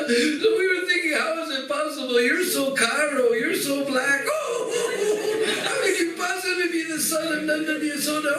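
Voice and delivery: high, laughing mockery; mocking boys. Cowherd boys mocking Krishna with high laughter.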